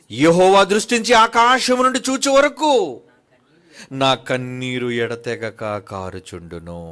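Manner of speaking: loud solemn reading that slows at the end; scripture recitation. Acted. Preacher reading Lamentations aloud to the church. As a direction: intone the verse, drawing out the last line. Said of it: "అందుకే అంటున్నాడు విలాపవాక్యములు".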